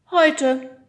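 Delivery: high, loud bright one word; high cheerful Oda. Acted. Oda answers Nemo, high and bright. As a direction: toss it off brightly. Oda's voice in story.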